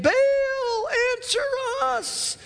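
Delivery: high, stretched cry to an idol; a pleading cry to a god who won't answer; desperate plea. Retold or imitated. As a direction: call out to Baal, pleading upward.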